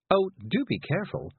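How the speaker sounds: high-pitched, concerned exclamation; startled caution. A narrator's reading. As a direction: fuss at her to take care. Miss Level cautions after a yelp.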